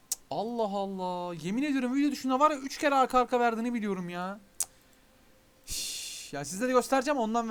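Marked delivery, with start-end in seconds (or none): 0.1-1.3 drawn-out, slow exasperated sigh of words. Real, not acted. Streamer fed up with the game's drops, slow exasperated. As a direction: drag it out in disbelief.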